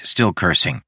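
plain reading voice

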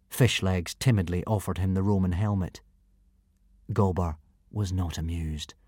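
plain reading voice

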